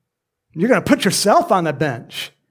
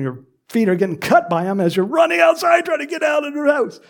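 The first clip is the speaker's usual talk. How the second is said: raised shout; 10 semitones higher than usual. Real, not acted. Earthquake escape scene.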